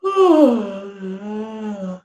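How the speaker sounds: long falling yawn sigh; open-throat yawn. Shown as a demonstration. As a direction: let a yawn fall out. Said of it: "just yawn".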